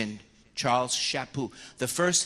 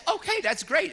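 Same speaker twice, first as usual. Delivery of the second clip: bright, much higher, louder "okay, that's great"; mock-enthusiastic priest; 13 semitones higher, 5 dB louder than usual. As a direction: play up the cheerful reassurance. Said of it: "I say".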